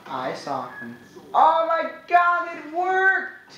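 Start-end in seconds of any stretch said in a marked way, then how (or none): 1.3-3.5 high excited exclaiming; thrilled. Real, not acted. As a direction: squeal with delight. Thrilled at first kill.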